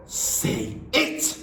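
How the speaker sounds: high, rasping command; bellowed. Acted. Headmistress bellowing an order at the boy. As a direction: force the words out of him. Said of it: "bellowed the Trunchbull".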